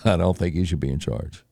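low, soft, dopey voter; doubtful voter. Retold or imitated. Voters watching Dukakis in a tank, low and mumbling. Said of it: "they're like".

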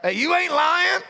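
high, loud call of a churchgoer; congregation call-back. Pretend. Preacher asks the church to answer.

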